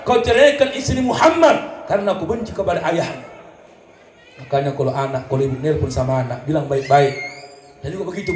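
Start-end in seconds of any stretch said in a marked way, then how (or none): 0.0-3.2 a mock stern mama ordering the divorce; lightly voiced bossy mother; mother's order. (ibu yang memerintah dengan nada tinggi)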